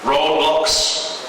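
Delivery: ordinary delivery, nothing marked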